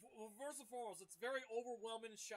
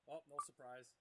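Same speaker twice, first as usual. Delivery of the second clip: ordinary talk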